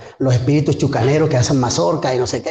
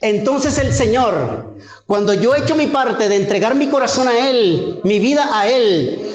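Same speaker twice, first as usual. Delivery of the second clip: higher, rising preacher's fervour; fervent preaching; 8 semitones higher than usual. Acted. Preacher exhorting the congregation, raised fervent voice. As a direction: preach it to the congregation with mounting conviction.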